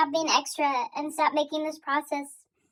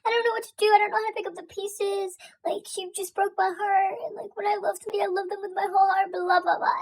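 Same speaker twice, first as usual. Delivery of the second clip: higher-pitched, whiny imitation of a lovesick guy; mock heartbroken boyfriend; 3 semitones higher, faster than usual. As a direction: rattle off his heartbreak mockingly. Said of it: "he's like".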